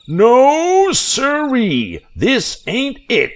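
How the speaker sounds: high, loud, slow furious yokel yell; filthy old man screaming after the boat. A narrator's reading. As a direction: bellow it like a cheated swamp hermit. Filthy man yells at fleeing boys.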